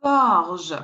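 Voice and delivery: gorge with hard g first, zh last; French pronunciation. Shown as a demonstration. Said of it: "you pronounce gorge".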